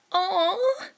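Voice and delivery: put-on high, bright chirp of a girl; high squeaky "aw" from an excited girl; perky girl voice. A narrator's reading. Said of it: "Ashido pipes up".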